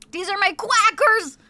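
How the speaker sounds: silly quackers voice. Pretend. Streamer joking about her crackers, silly high cartoon voice. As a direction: pun it like a cartoon duck.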